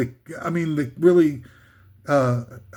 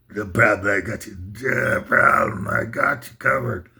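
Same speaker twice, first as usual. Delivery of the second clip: [name] impression; 8 semitones lower than usual. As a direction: mumble it like a gruff heartland rocker. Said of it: "my [name] imitation".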